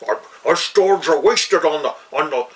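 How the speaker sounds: imitating a hammy movie-dwarf Scottish brogue; mock Scottish accent. Actor told to play the dwarf, in mock Scottish accent. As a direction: roll it out in a put-on Scottish dwarf voice. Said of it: "they gave him, you know".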